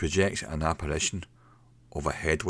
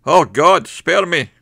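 high, loud panicked cry for mercy; terrified shout; 12 semitones higher, 13 dB louder, slower than usual. A narrator's reading. Barman begging God in terror, high panicked shout. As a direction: shriek it out in terror. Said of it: "he shouts".

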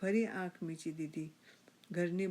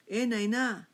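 raised, louder call; 5 semitones higher, 7 dB louder, much slower than usual. A narrator's reading. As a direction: holler for the wife. Calls wife from another room.

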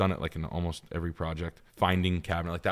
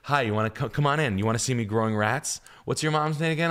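higher, louder overly cheery greeting; mock chipper host; 6 semitones higher, 5 dB louder than usual. Retelling the rat owner who welcomed him in, mock cheery. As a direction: play the oddly friendly rat owner, bright and loud. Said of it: "They're like this".

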